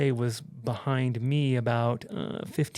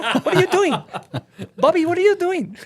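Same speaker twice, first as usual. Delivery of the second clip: very high, loud cute French voice; Annie; 8 dB louder than usual. Retold or imitated. French friend scolding the man who ran, loud cute French voice. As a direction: squeal it in an imitated French accent. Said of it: "Annie's little cute little French, French voice".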